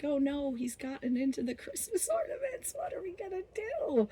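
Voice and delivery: high-pitched helpless mock worry; mock dismay. Friends hearing him smash ornaments, mock dismay.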